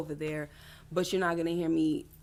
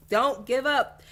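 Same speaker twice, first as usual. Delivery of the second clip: loud, higher-pitched cheer; emphatic encouragement; 7 semitones higher, 10 dB louder, slower than usual. Real, not acted. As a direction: urge her on, punching it out loudly. Cheering on a viewer.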